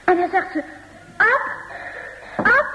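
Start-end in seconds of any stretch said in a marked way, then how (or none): 1.2-2.7 excited calling. Acted. Mother calling Ab, overwhelmed.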